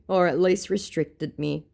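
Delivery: ordinary talk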